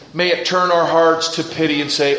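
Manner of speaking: ordinary talk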